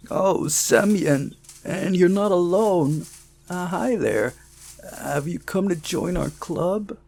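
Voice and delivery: a second, fuller take on the character; redone boy's voice. Shown as a demonstration. Narrator redoes the club boy greeting Semyon. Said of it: "Hang on, sorry".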